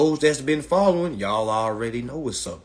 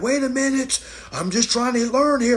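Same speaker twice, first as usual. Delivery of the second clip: high, louder hurt whine; mock offended learner; 12 semitones higher, 4 dB louder than usual. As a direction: imitate a defensive student, pitched way up. Mocking people who get mad.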